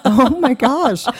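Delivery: slow, high, laughing "oh my gosh"; drawn-out embarrassed gasp of a phrase; mock dismay. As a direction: caught out, half laughing. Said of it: "And I was like".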